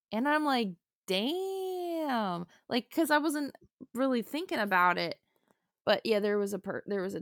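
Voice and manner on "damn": high, long-held "damn"; stunned realization. Retold or imitated. Learning from friends about her accent, amazed. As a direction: draw it out high in retold amazement.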